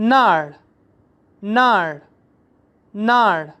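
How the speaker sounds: नाड़ short with falling tone; teacher shows variant; alternate pronunciation. Shown as a demonstration. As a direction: clip नाड़ with a fall. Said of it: "अगला है there".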